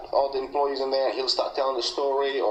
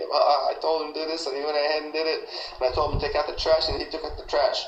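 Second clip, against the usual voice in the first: higher-pitched, quick mimicking of a gloating coworker; mocking retelling; 5 semitones higher, faster than usual. Coworker mocking the new guy to everyone, mocking. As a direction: recount it higher and faster, as the mocker.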